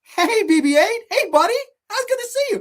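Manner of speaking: chirpy hammed-up hero greeting his droid; mock Poe Dameron. Mocking Poe greeting his droid, in a high cheery voice. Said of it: "I'm gonna tell you Poe Dameron's character".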